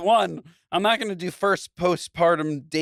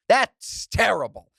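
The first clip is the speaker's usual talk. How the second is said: normal talking voice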